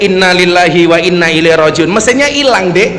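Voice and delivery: ordinary talk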